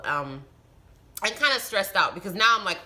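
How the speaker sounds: ordinary talk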